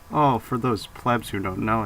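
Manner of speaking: ordinary delivery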